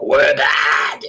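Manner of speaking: loud evil villain shout; evil demonic shout. Retold or imitated. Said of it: "they're like".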